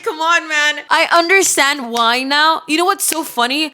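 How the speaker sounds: normal talking voice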